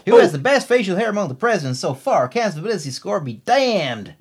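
exaggerated American accent, swooping pitch; Louisiana accent attempt. Shown as a demonstration. Co-host reading listener's question in attempted Louisiana accent. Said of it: "No, same accent as before".

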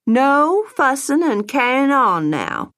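fond, drawn-out Atlanta grandmother scolding lightly; a loving Atlanta mother easing a goodbye, slow Southern lilt. Retold or imitated. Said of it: "John's Atlanta-born mother was fond of using".

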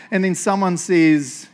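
ordinary talk, nothing marked

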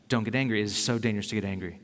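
low, slightly hushed warning; mildly voiced parental caution against anger. Family warning a child off anger, low cautioning. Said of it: "Did they say anger is dangerous and bad".

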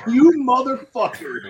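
high, loud, slow retold curse; mock pissed-off. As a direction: imitate him cursing out the prankster, drawn out. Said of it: "I was so pissed".

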